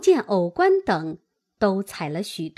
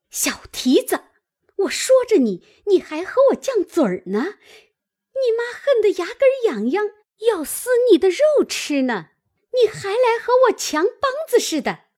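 put-on high-pitched, furious old woman's scolding; angry cursing; 12 semitones higher, 3 dB louder than usual. A narrator's reading. Old woman curses her niece while striking her, high and furious. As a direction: berate the girl, voice climbing high. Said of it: "骂道".

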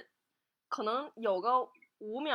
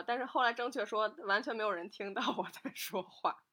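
ordinary talk

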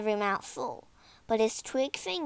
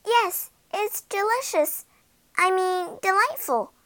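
put-on high, loud child's voice; flustered little girl; 9 semitones higher, 7 dB louder than usual. A narrator's reading. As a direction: pipe up high like a startled girl. Gretel flustered, answering the witch.

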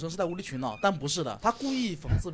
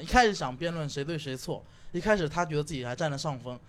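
ordinary delivery

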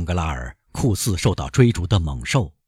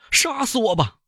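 high, desperate kill me; high desperate banker crying out; loud plea. Acted. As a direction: beg him to end it. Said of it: "唐格拉尔大声地说".

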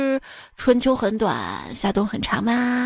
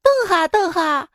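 higher and louder, calling after someone in Xi'an dialect; foreigner in Xi'an dialect; 6 semitones higher, 3 dB louder than usual. Retold or imitated. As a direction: shout after them, laughing, with a local twang. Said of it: "只见后面老外追着我说".

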